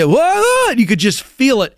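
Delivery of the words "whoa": high, startled gasp of a word; startled whoa. Retold or imitated. Beach crowd spotting the brown blob, high startled whoa. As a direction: sound the crowd's alarm.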